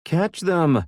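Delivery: high, loud frantic shout. A narrator's reading. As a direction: scream the order. Maddie yelling in chase.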